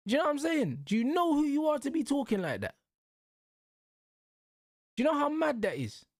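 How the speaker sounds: exasperated disbelief. Real, not acted. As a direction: pitch it up in outraged disbelief. Exasperated rant at footballer's ego.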